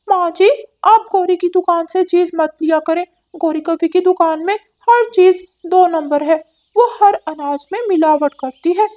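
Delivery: high, bright cartoon parrot voice; talking parrot; chatty parrot. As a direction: squawk it out like a cheeky parrot bird. Parrot speaks to grandmother.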